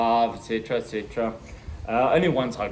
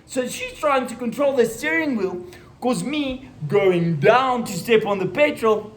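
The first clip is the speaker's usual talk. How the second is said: usual speaking voice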